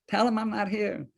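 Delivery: high hushed plea from the office. Retold or imitated. Lawyer begging his secretary from his desk, hushed. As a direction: hiss it so the caller can't hear.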